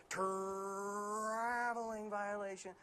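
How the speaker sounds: long, high stretched-out first syllable; slow, drawn-out referee call over the arena mic; arena announcer voice. Shown as a demonstration. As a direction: needle the visitors with a dragged-out PA call. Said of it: "his arena voice takes over".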